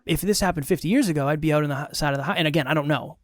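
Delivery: ordinary talk, nothing marked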